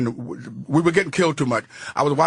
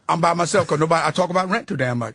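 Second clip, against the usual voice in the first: usual speaking voice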